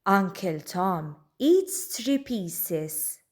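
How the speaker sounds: ordinary delivery, nothing marked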